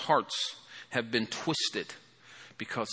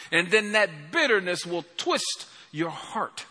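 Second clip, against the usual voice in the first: preaching at full force; 6 semitones higher than usual. Acted. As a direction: hammer each line home to the congregation.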